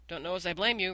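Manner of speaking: ordinary delivery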